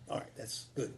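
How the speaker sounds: soft unvoiced aside to yourself; whispered self-check. Real, not acted. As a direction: reassure yourself under your breath. Self-check whisper mid-reading.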